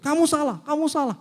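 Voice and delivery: high, sharp accusation; accusing. Acted. Someone blaming another person, high sharp accusing. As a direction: point the finger at them.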